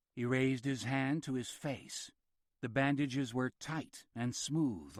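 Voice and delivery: ordinary delivery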